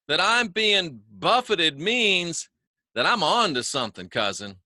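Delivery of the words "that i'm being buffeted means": very slow, careful words; slowly. Shown as a demonstration. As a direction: spell out buffeted slowly so the tongue doesn't twist. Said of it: "I have to say that slowly".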